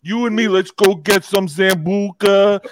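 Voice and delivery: high, loud, pushy drinking-buddy voice; hammed-up overfriendly character; Joe. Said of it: "he's gonna say".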